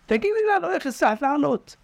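high, insistent asking; pressing question. Acted. Frogs pressing the climber for her secret, high and insistent. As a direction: demand the secret eagerly.

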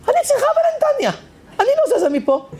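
put-on high, insistent voice of an old woman; stubborn old woman. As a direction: squeak it up like a stubborn grandma. (זקנה עקשנית בקול גבוה)

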